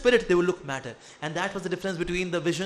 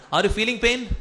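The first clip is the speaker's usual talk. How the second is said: ordinary talk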